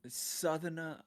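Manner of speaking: imitated southern English accent. American echoing his English friend, southern English accent.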